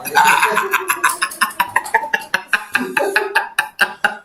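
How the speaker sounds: high staccato laughter; long laugh. Real, not acted. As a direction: laugh on and on in short bursts. Laughing after joke about comments. (tertawa panjang terkekeh)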